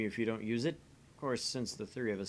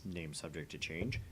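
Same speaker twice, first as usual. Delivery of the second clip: dry aside; 5 semitones lower, 6 dB quieter than usual. Acted. Parenthetical aside in game text.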